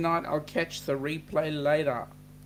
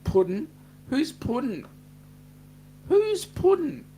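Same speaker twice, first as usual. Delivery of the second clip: mock confusion; 7 semitones higher, 3 dB louder than usual. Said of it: "Troy is saying".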